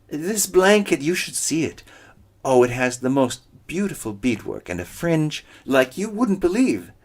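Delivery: high, loud, slowed sweet-talk; high eager coyote pleading; flustered flattery. Acted. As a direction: scramble to sound nice again.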